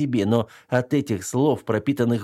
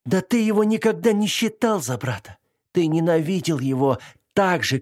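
furious accusation; 7 semitones higher than usual. Acted. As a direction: hurl the accusations at him. Widow accuses him furiously.

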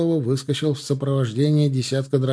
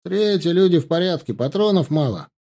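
raised, higher complaining report; grumbled report; 6 semitones higher, 4 dB louder than usual. A narrator's reading. Blokhin reporting ammunition shortage, grumbling. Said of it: "проворчал стоящий рядом Блохин".

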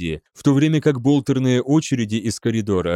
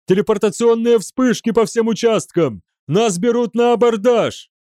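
high, alarmed yell; panicked howl; 10 semitones higher, 4 dB louder than usual. A narrator's reading. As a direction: cry out the threat in panic. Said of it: "взвыл офицер слежения". (панический вопль)

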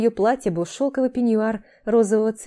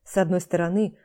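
ordinary delivery

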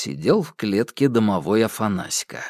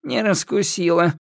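witch voice; 10 semitones higher than usual. A narrator's reading. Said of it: "вздохнула Яга".

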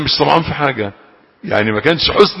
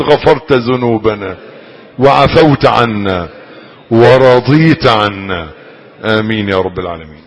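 fervent prayer; 2 semitones lower, 5 dB louder than usual. Acted. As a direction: plead with God, stretching each phrase. Preacher leads closing prayer.

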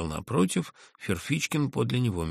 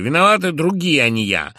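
louder and higher, more and more worked up; growing irritation; 8 semitones higher, 11 dB louder than usual. A narrator's reading. The narrator angrily blames the others, growing irritated. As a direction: build the anger line by line. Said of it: "раздражаясь всё более и более".

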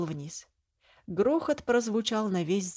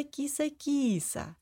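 higher, slow drawn-out teasing; 7 semitones higher, slower than usual. Acted. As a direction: stretch the taunt slowly. Prince teases with the nickname.

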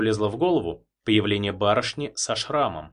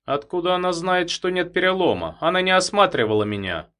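indignant; 8 semitones higher, 4 dB louder than usual. A narrator's reading. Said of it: "возмутился он".

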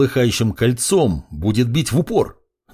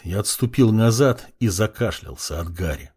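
plain reading voice

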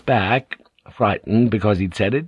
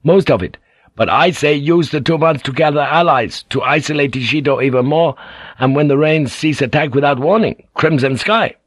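lightly voiced youth, higher and keen; eager young samurai; 6 semitones higher, 3 dB louder than usual. A narrator's reading. Son urging his father to attack, eager. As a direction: press the attack plan hard.

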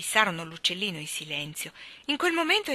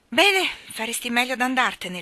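warning; 5 semitones higher, 6 dB louder than usual. Acted. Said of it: "l'avvertì Mary Poppins".

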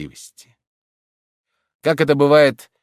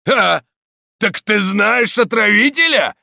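high, loud accusing cry; menacing exclamation; 11 semitones higher, 6 dB louder than usual. A narrator's reading. Stonecutter grabbing the priest, menacing accusing cry. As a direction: pounce on him with suspicion. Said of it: "воскликнул камнелом".

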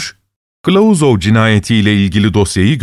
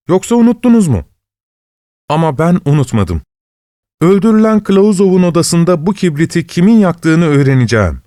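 higher-pitched, lightly acted eager sleuth; insistent investigator's assistant; 6 semitones higher than usual. Assistant argues the Swedish match clue to the judge, insistent. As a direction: press the theory with mild agitation.

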